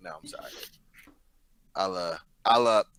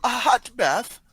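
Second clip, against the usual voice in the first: butler voice; 11 semitones higher, 3 dB louder, much slower than usual. Acted. Butler voice, pitch jumps up.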